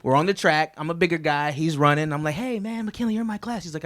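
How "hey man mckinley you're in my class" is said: higher, lighter voice of his younger self; imitating a friendly kid calling a classmate; eager kid greeting. Third grader to McKinley, high eager greeting.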